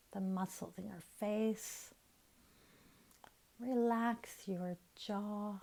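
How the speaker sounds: soft, unhurried meditation voice with long pauses; guided meditation. Real, not acted. Teacher guiding listeners to relax their face and jaw, soft meditation voice. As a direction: ease them gently into relaxation, leaving room to breathe.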